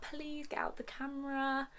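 ordinary delivery

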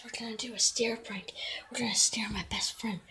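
quick hushed whisper. Real, not acted. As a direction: whisper the plan fast. Whispering prank setup.

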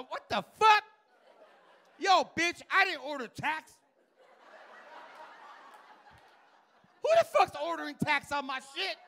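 high, loud indignant yelling; outraged shout. Retold or imitated. Comic playing a customer furious about the bill. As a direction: yell it like you've been cheated.

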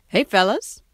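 bright, loud hello to the pets; cheerful call. A narrator's reading. Pete greeting his flying pets, loud and cheerful. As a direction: call out happily to the critters.

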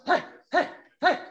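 three short, high huffs, spaced apart; partial breath bursts. Shown as a demonstration. Coach showing that it can be just partial, short high exhales. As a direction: let out little sharp puffs of air. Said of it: "It can be just partial".

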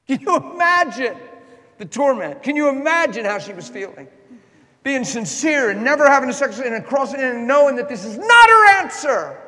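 high, loud, impassioned preaching; full-force preaching. Acted. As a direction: drive it home to the congregation.

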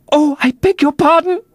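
very high, alarmed little cry; crying out in apology. A narrator's reading. Alice has offended the mouse, high flustered apology. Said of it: "cried Alice again".

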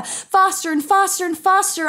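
ordinary delivery, nothing marked

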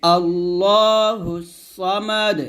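slow, stopping on সামাদ with the দ bouncing; slow আল্লাহুস সামাদ with bouncing final d; qalqala on final dal. Shown as a demonstration. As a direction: pause on সামাদ and let the dal echo. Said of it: "ওয়াকফ ধরলে এই দালটা কলকল করতে হবে".

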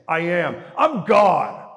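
loud and high, hurled out; ringing proclamation. Acted. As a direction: shout the claim to the whole room. Preacher proclaims Jesus' words.

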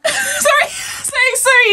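high, giggling sorries; laughing apology. Real, not acted. After screaming at the trailer, to viewers: laughing apology.

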